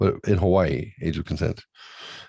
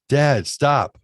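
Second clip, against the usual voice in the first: higher, louder mock protest; annoyed son; 9 semitones higher, 6 dB louder than usual. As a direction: imitate the fed-up teen. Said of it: "the tone changes".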